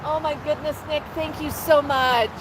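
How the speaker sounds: high, sing-song coaxing voice for a parrot; pet talk. Acted. Host cooing at her parrot Max on livestream, high sing-song. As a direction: coo and coax the bird like a toddler.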